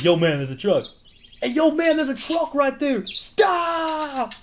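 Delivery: high, loud frantic shout; hammed-up panicked yelling; panicky warning. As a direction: warn him of the truck in a panic. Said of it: "And he's like".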